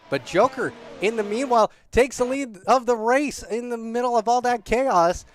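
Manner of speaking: loud, rising call of a new leader; excited race call. Real, not acted. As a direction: call the lead change with energy. Commentator calls the new leader.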